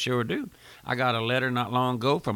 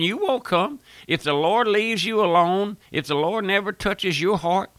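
preaching at full force; 6 semitones higher than usual. Acted. Preacher warning radio listeners, preaching at full force. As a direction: drive the warning home, voice raised high.

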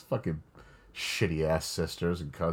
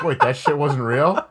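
surprised; 7 semitones higher, 8 dB louder than usual. Retold or imitated. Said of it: "I was like".

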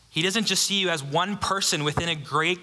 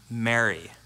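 Jesus calling her name; 4 semitones lower than usual. Acted. Jesus's one-word call repeated.

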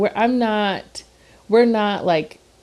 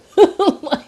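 high laugh; 12 semitones higher, 5 dB louder than usual. Real, not acted. Laughing at own joke.

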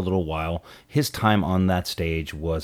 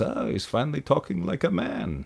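higher, softer lightly voiced Tevye aside; a hint of old Tevye musing to the audience; Tevye's wry aside to the camera, mildly voiced; Tevye impression; 7 semitones higher, 5 dB quieter than usual. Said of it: "Tevye turns to the camera and he says".

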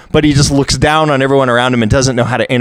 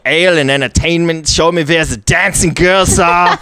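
higher-pitched, hammed-up German accent; loud German inventor; 5 semitones higher than usual. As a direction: demand it in a comic German accent. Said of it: "that was how I played him".

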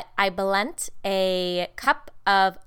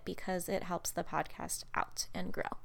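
normal talking voice

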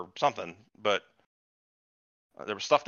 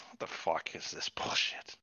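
slow, soft, unvoiced whisper; muttering at the screen in a slow whisper; whispered disbelief; 5 semitones lower, 10 dB quieter, slower than usual. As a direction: hiss it under your breath slowly. Whispered reaction quote.

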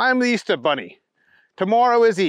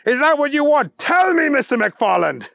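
building, higher-pitched harangue; indignant bunny; 8 semitones higher, 3 dB louder than usual. A narrator's reading. Bunny pressing Booger about cancelling Easter, rising indignation. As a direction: press harder until "tell me" rings out high.

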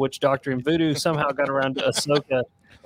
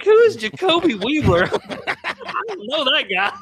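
laughing disbelief; 11 semitones higher, 4 dB louder than usual. Retold or imitated. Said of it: "I was like".

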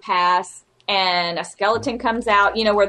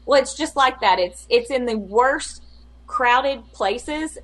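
ordinary talk

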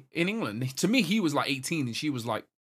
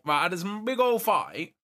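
normal talking voice

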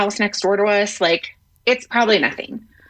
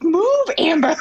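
urgent warning; 9 semitones higher than usual. Retold or imitated. Piece of plane falling toward them.

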